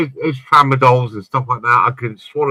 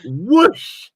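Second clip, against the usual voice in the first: high mock whoosh; 11 semitones higher than usual. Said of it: "I was like".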